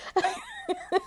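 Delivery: high tearful laugh, voice breaking; a choked laugh through tears; emotional laugh-cry. Real, not acted. Mother thinking of her children, a tearful laugh. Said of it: "I just become so emotional".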